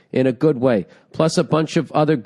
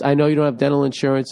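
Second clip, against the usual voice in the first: usual speaking voice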